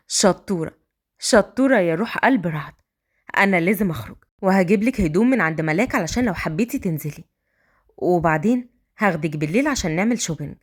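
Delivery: low tender man's voice; tender husband. A narrator's reading. Raad praises.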